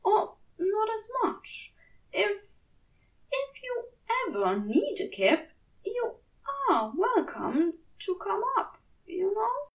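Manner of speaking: a hesitant angel, softening toward the end; shy offer. A narrator's reading. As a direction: stumble over the invitation shyly. Aziraphale shyly offers his bed.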